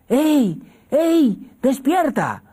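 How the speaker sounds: put-on high, loud calls to wake the child; excited wake-up call. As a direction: shout him awake, thrilled. Madre despierta al niño. (grita emocionada para despertar al niño)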